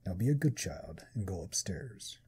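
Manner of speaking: slow, low whispered menace; demonic whisper. Acted. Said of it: "I'm giving her a demonic voice".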